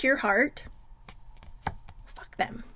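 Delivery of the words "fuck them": soft unvoiced whisper; whispered curse. Real, not acted. Cursing the heartbreakers to viewers, whispered. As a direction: hiss it under the breath.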